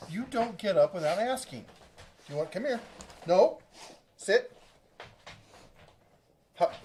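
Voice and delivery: firm and sharp, make her sit; firm dog commands. Real, not acted. Owner ordering the dog to sit, firm and loud.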